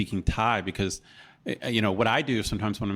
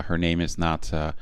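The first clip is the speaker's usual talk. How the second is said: ordinary delivery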